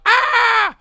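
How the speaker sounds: a very high, loud mock scream; imitated scream. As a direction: shriek like a girl charging in. Imitating a girl charging in.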